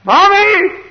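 very high, loud yell; lost child's scream. Retold or imitated. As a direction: scream for mother as a lost kid. Child's call turns to scream.

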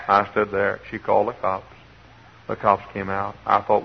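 ordinary delivery

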